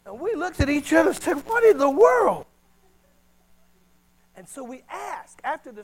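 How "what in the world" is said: high, loud what in the world; astonished. Retold or imitated. Couple in a Philippine church reacting, loud and astonished. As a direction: gasp it out in disbelief.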